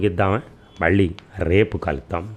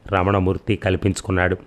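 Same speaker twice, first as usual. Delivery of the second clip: ordinary delivery, nothing marked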